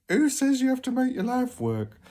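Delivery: high, loud incredulous question; exasperated outburst. Real, not acted. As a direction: throw the question up in disbelief. Rhetorical outburst.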